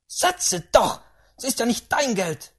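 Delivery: loud, high order; exasperated old lady; impatient great-aunt. Acted. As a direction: snap at him to obey. Great-aunt cuts off objection.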